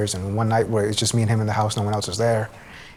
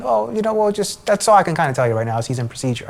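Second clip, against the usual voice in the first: much higher, fast babbling brush-off; mock evasive doctor; 8 semitones higher than usual. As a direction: rattle it off like a doctor dodging the question. Female doctor stalling.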